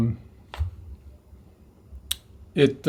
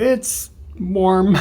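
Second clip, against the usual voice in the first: laughing; 6 semitones higher than usual. Real, not acted. Laughing at own camera setup.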